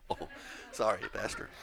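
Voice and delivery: ordinary talk, nothing marked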